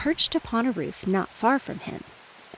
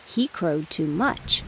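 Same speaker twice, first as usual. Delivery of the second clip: lower, slow, stressing much; slow low dog line; 3 semitones lower, slower than usual. Acted. Dog gives his verdict slowly. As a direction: say it slow and heavy. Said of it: "said the dog".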